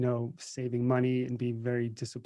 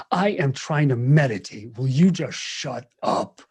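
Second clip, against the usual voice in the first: annoyed shushing woman; 7 dB louder than usual. Retold or imitated. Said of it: "this woman came up to our window and was like".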